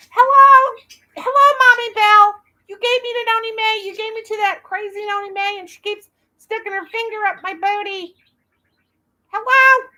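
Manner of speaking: high squeaky little doll complaining to its mommy; high, loud childlike doll voice calling out. Pretend. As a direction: pitch way up and play the doll. Doll talking to its owner.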